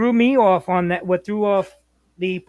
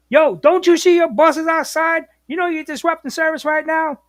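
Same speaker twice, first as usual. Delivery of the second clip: raised, higher mock scolding; storming in; 7 semitones higher, 5 dB louder than usual. Dispatcher catches driver at the gas station, storming-in scolding. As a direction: imitate an irate dispatcher barging in on a driver. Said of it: "He comes storming in".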